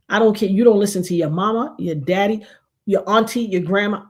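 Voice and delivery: ordinary talk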